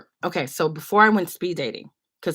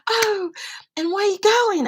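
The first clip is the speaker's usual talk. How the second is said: much higher and louder, gushing kind lady; sweet old lady; 5 dB louder than usual. Retold or imitated. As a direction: squeal with delight like a doting auntie. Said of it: "the lady keeps asking".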